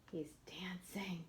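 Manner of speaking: soft whispered secret. Acted. Telling the listening children a secret, whispered. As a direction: let the kids in on a secret.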